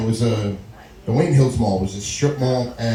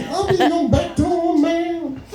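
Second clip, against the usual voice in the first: high, slow giggling line; high, slow and giggly; laughing punchline; slower than usual. Acted. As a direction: crack up on the joke.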